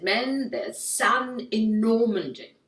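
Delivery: slow, drawn-out wartime address; rally the troops as a mock British statesman; Winston Churchill. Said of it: "that's why Churchill won the war. I think he said".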